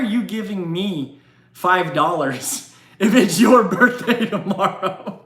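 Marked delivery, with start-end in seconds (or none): 3.0-5.2 laughing. Real, not acted. Laughing at a viewer's gift.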